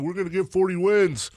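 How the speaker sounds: high mocking voice; mock the team's boast; mocking quote. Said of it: "people making fun of those guys for saying".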